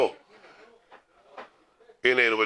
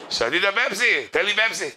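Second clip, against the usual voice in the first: boastful enthusiasm; 7 semitones higher than usual. Acted. Acts Pepsi boss ordering proudly.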